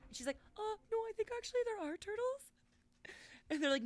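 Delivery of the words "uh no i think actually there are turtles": put-on high little-girl voice; lightly voiced little girl. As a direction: play the earnest kid.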